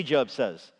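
ordinary talk, nothing marked

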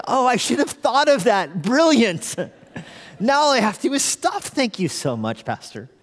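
high-pitched sarcastic epiphany; hammed-up sarcastic enthusiasm; mock delight. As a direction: gush as if useless advice were genius. Mock gratitude for useless advice.

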